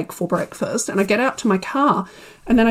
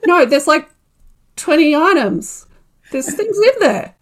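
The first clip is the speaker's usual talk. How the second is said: flustered insistence; 6 semitones higher, 3 dB louder than usual. Retold or imitated. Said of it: "And I'm like".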